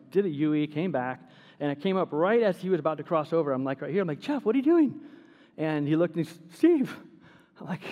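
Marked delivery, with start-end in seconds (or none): 4.2-5.0 calling out. Retold or imitated. Youth pastor calling to Jeff by the road, calling out. 6.5-7.0 higher, louder startled name; surprised call. Retold or imitated. Jeff startled to see the youth pastor, surprised.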